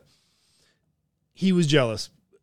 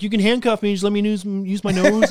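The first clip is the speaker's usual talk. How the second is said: raised, louder bargaining voice; insistent pleading; 6 semitones higher, 6 dB louder than usual. Retold or imitated. Arrested man bargaining with cops, mock insistent plea. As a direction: retell a desperate bargain, playing it for laughs.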